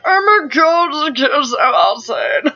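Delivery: put-on high, loud angry little old man; excited shopper voice. Vlogger mimicking herself when excited, angry old man squawk. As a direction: squawk with over-the-top excitement. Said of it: "When I get really excited".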